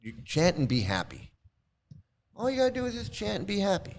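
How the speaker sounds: mock cheery recruiter promise; mock recruiter pitch. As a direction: sell it sweetly and fast. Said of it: "they told her".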